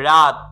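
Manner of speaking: রাত as one loud syllable; single-syllable demonstration. Shown as a demonstration. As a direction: say রাত in one firm burst. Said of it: "একটা উচ্চারণ হচ্ছে".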